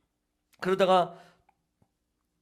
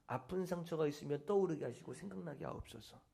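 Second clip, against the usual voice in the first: much softer, hushed repeat of the prayer; 4 semitones lower, 12 dB quieter than usual. Shown as a demonstration. Preacher repeats the prayer for the congregation, hushed. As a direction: breathe the prayer out quietly. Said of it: "이것이 치유 기도문이에요".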